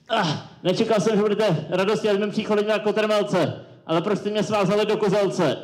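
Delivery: put-on high voice, protesting; hammed-up Count Tolstoy.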